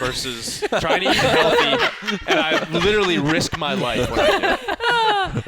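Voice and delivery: high, slow laughing exaggeration. Real, not acted. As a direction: laugh the complaint out, slowly.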